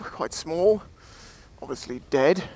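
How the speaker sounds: ordinary talk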